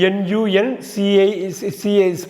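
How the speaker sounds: ordinary delivery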